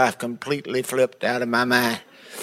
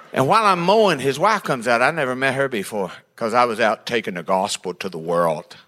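normal talking voice

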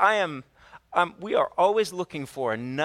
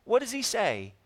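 usual speaking voice

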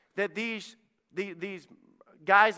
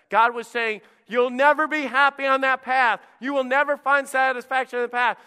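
louder, higher preaching voice; full-force preaching; 8 semitones higher, 8 dB louder than usual. Acted. Pastor to congregation warning of storms, fervent preaching.

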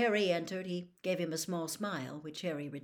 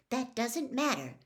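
firm, higher-pitched insistence; 6 semitones higher than usual. A narrator's reading. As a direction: brush the worry aside firmly. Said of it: "said Hermione firmly".

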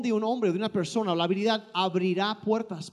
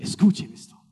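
a whispered aside; 5 dB louder than usual. Acted. Interpreter whispering to the congregation to listen. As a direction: whisper it with urgency.

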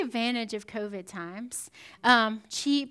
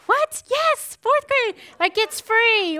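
high, loud delighted cry; overjoyed by free park entry; thrilled; 9 semitones higher, 7 dB louder, slower than usual. Retold or imitated. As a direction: squeal with delight at the news.

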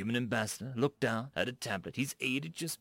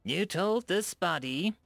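slow, higher and louder threat; slow menace; 8 semitones higher, 5 dB louder, slower than usual. A narrator's reading. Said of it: "slowly and with menace".

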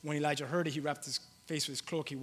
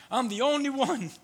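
despairing Elijah; 10 semitones higher, 8 dB louder than usual. Acted.

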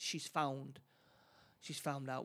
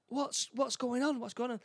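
alarmed shout; 8 semitones higher, 6 dB louder than usual. Retold or imitated. Heroine confronting Connie in fright, high and loud. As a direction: demand answers in sudden fright.

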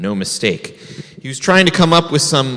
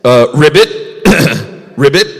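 frog ribbit; 4 dB louder than usual. Acted. Rabbit pretends to be a frog for the bear. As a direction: croak it like a bullfrog.

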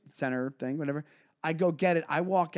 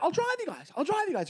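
much higher, pushy mock host; eager host voice; 14 semitones higher than usual. Comic imitating the host offering a ride, much higher. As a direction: insist like the clingy host.

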